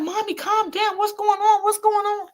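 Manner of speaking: daughter's high, urgent calm-down; imitating worried daughter. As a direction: pitch way up as the panicked daughter. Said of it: "She was like".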